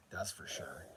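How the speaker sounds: a soft whispered aside. Real, not acted. Joking to viewers about loving to talk, whispered aside. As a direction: drop to a whisper for the joke.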